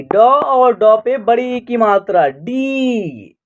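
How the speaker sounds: "डी" held long on its vowel; pronunciation demo. Shown as a demonstration. Teacher showing learners how to pronounce the letter, "डी" drawn out. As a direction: spell it out slowly, ending on a long falling "डी". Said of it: "तो इसको उच्चारण करेंगे".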